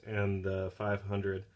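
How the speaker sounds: ordinary delivery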